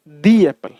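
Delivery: दी एप्पल with a long ee, "dee apple"; "dee" before apple. Shown as a demonstration. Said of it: "द एप्पल नहीं बोलेंगे". (दी को "डी" की तरह लंबा बोलें)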